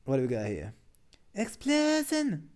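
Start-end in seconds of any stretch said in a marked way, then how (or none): 1.3-2.5 much higher, stretched-out anime spell cry; spell shout. Acted. Streamer reads the anime meme, stretched high spell cry. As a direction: drag the word out like casting a huge spell.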